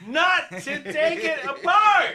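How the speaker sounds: very high, drawn-out mock shout; hammed-up parental explosion, each word stretched; exasperated parent yelling.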